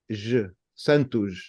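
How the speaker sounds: Portuguese "Santos" ending in zh; voiced j ending. Shown as a demonstration. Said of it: "a J without the".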